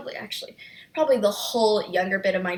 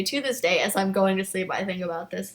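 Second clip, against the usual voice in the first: ordinary talk, nothing marked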